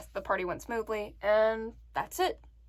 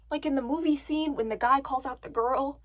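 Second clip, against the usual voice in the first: ordinary talk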